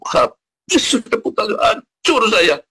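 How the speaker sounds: high, drawn-out lament; despairing outcry. Retold or imitated. Preacher reliving his downfall, despairing outcry. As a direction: stretch out the ruin in a high-pitched wail. Re-enacts despair over ruin.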